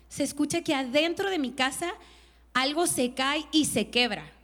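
ordinary talk, nothing marked

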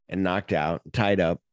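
ordinary talk

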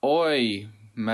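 a quick, lightly put-on Australian Oi mate; Australian accent. Asked for an Australian accent, answering with a light Australian accent. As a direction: toss off an Australian greeting, only a hint of the accent. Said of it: "talk in your best Australian accent".

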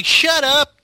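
loud high yell; shouting. Retold or imitated. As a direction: snap at him loudly. Han shouting at C-3PO.

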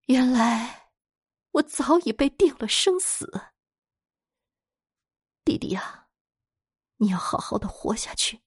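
high, hushed, grieving farewell; choked up. A narrator's reading. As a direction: choke back tears saying goodbye to your brother. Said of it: "他突然哽咽起来".

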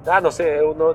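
ordinary talk

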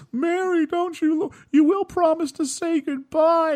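put-on very high, slow pleading kids' voice; begging children. Children begging Mary Poppins to say goodbye, high whine. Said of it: "the children are just like".

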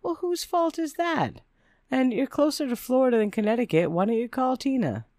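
high-pitched, pointed comeback; mock retort. A narrator's reading. Acted retort to whining ex.

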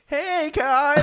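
high-pitched, singsong mock hello; teasing greeting. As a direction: imitate a girl calling out knowingly. Girl greets him knowingly, retold.

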